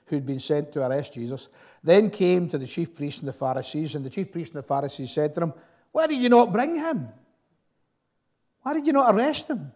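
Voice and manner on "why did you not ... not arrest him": higher, louder accusing questions; indignant demand. Acted. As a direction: mildly act the priests' outrage. Chief priests questioning the officers.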